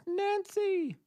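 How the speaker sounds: very high, drawn-out goddess call; Mother Nature voice. Pretend. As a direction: call her name like a goddess from above. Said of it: "or would it sound more like that?".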